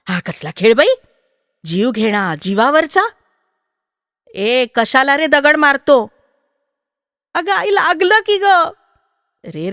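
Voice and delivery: loud, high, pleading scolding; women shouting at rough boys, high and loud. A narrator's reading. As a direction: shout at them to stop.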